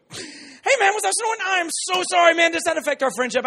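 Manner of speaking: half-awake friend panicking, very high and loud; startled roommate. Retold or imitated. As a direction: blurt it out like someone jolted from sleep.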